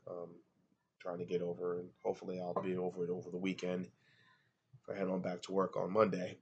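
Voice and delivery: ordinary talk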